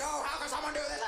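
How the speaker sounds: high, hammed-up anguished wail; mock crying. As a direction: cry it out in exaggerated despair. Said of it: "Everybody's crying".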